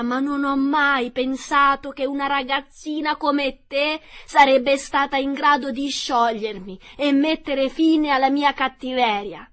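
high, loud witch voice, lamenting to the end; dying witch. Acted. Melting witch bidding Dorothy farewell, lamenting. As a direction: rant out a last witch farewell.

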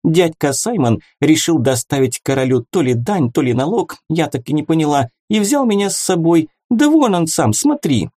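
high, quick chatter; rattling fast. A narrator's reading. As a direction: rattle it off breathlessly. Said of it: "затараторила".

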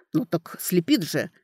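usual speaking voice

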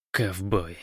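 hoarse loudspeaker mutter; hoarse croak. A narrator's reading. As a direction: croak it out, spent after yelling. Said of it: "прохрипел динамик".

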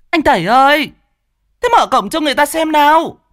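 loud, shrill woman's holler; high-pitched yelling. A narrator's reading. Angry woman calling her man to open the gate, yelling. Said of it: "gào lên".

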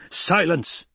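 high, sharp cry for silence; imperial command. Acted. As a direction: cut the pleader off. Emperor cuts off the Baron again.

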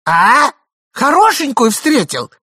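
high loud jealous demand; sharp loud shriek. A narrator's reading. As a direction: pounce on him. Wife pounces.

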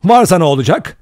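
loud, hard challenge; sharp, defiant retort; harsh voice. A narrator's reading. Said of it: "sert bir sesle sordu".

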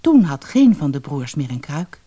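plain reading voice

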